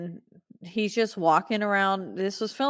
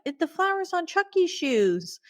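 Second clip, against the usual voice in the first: put-on high-pitched, urgent kid voice; urgent child; 11 semitones higher than usual. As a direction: cry it out like a kid desperate to be believed. Child urgently telling the detective.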